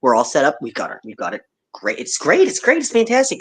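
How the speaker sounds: normal talking voice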